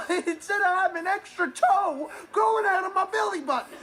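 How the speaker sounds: high, exasperated recounting of an absurd rumour; indignant protest. Acted. Indignantly recounting a rumour about himself.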